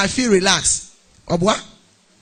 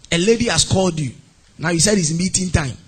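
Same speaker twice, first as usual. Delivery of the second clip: ordinary delivery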